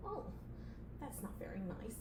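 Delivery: very quiet, wounded aside; meek little protest; hurt mumble. Acted. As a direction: say it small, under your breath. Assistant hurt, under breath.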